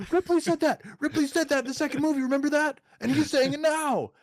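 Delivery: very high, loud mocking squeal; hammed-up thrill, pitched far above normal; mock fan excitement. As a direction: imitate gushing moviegoers who spotted the callback. Mimicking thrilled audience members.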